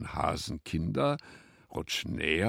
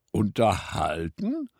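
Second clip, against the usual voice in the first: slower, higher puzzled echo; surprised question; 4 semitones higher, 7 dB louder, slower than usual. A narrator's reading. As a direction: ask it back in wonder, drawn out. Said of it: "dass sie verwundert war". (verwundert nachgefragt)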